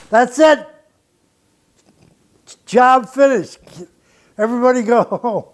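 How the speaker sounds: loud, high wrap-up call. Retold or imitated. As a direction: announce to the whole room. Announcing end of session.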